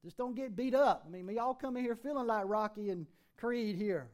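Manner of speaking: ordinary delivery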